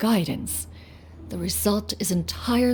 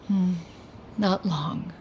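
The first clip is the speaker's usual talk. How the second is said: usual speaking voice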